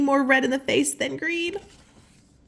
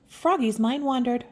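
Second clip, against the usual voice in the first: ordinary delivery, nothing marked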